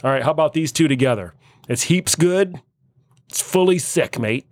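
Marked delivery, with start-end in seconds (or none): 1.7-4.5 higher, playful Aussie accent; Australian accent. Shown as a demonstration. Host gives the next slang test, Australian accent. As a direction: lift it into chummy Australian slang. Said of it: "See if we can understand some Australian".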